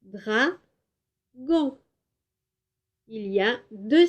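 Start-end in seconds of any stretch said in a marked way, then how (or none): 0.0-1.8 Dragon said as dra-gon, detached beats; syllables tapped. Shown as a demonstration. Teacher repeating dragon in syllables for counting. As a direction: tap out dra-gon once more. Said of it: "Combien de syllabes?".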